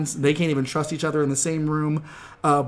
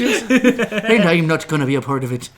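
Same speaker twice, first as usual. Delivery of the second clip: put-on Irish accent; 4 dB louder than usual. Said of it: "he sounds like a leprechaun".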